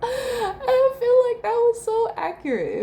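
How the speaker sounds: laughing. Real, not acted. Laughing after baby video.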